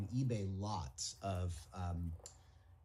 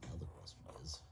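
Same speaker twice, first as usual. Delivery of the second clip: whispering; 12 semitones lower, 10 dB quieter, faster than usual. Real, not acted. Muttering to himself while working.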